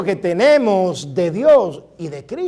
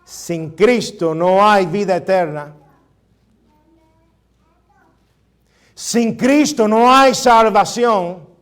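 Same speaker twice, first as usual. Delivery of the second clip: building emphasis; 3 semitones higher, 5 dB louder, slower than usual. Real, not acted. Preacher building to a climax.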